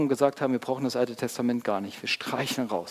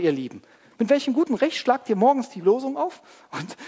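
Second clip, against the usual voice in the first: high, loud, urgent sermon delivery; impassioned preaching; 8 semitones higher, 7 dB louder than usual. Real, not acted. Preacher confronting listeners about Israel's promises, impassioned. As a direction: preach it with raised voice and force. Preacher rises to full force.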